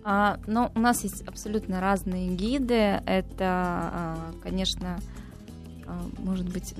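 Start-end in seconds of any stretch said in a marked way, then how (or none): none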